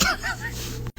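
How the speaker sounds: high, soft giggle; high laugh. Real, not acted. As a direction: giggle at your own foolishness. Laughing at herself.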